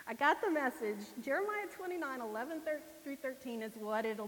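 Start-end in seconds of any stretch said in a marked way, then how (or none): 0.1-1.0 laughing at the obvious sign; amused, laughing repeat. Real, not acted. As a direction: repeat it through a laugh, louder.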